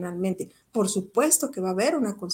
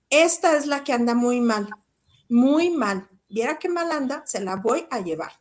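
madre quejosa; 3 semitones higher than usual. Retold or imitated. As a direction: act out a mother handing over her troublesome daughter. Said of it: "me la lanza prácticamente y me dice". (madre harta, imitada con énfasis)

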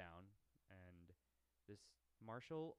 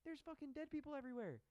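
high, loud mock exasperation; hammed-up high-pitched retort; exasperated; 6 dB louder than usual. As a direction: yell back at an idiot. Exasperated imagined reply to marshal.

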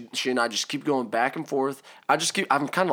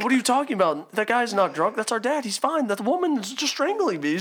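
high, incredulous retold protest; indignant correction; 10 semitones higher than usual. Kids explaining to the hostess, indignant and high. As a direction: set the hostess straight, voice pitched up.

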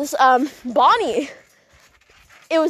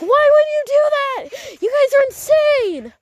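very high, slow frantic scream; 12 semitones higher than usual. Retold or imitated. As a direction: shriek it out in disbelief. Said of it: "started, like, screaming her head off".

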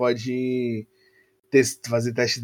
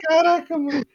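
high, astonished exclamation; amazed. Retold or imitated. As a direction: blurt it out in surprise. Retold reaction in the car seat.

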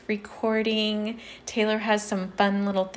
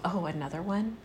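unimpressed; 9 dB quieter than usual. Retold or imitated. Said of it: "do you just go to yourself".

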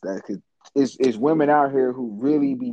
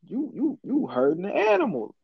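mocking voice; 6 semitones higher than usual. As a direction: sneer at her, stretched out. Mocking the dog owner.

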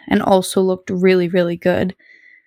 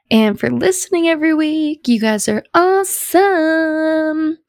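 high gushing thanks; 7 semitones higher than usual. Real, not acted. Sign-off thanks.

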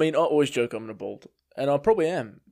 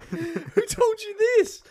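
very high, drawn-out mock bafflement; hammed-up disbelief, laughing; incredulous; slower than usual. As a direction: squeal the question slowly through laughter. Acts out own baffled demand, laughing.

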